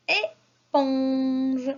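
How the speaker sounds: "éponge" slowly split into "é" and "ponge"; teacher sounding out "éponge" for children; syllable by syllable. Shown as a demonstration. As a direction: stretch "éponge" so each syllable is heard. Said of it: "la syllabe que j'entends".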